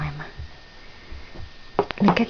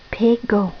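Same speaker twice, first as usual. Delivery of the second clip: ordinary talk, nothing marked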